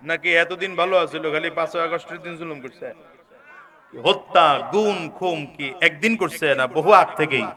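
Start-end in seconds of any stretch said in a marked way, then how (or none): none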